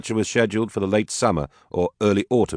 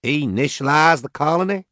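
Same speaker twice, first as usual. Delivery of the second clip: higher, louder disbelieving Southern echo; incredulous; 10 semitones higher, 3 dB louder than usual. Acted. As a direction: repeat it in disbelief. Max interrupts in disbelief.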